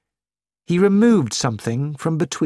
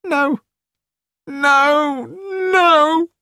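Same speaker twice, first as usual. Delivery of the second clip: anguished; 13 semitones higher, 3 dB louder, much slower than usual. A narrator's reading. Nigel learns Pat is dead.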